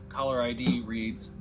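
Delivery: ordinary delivery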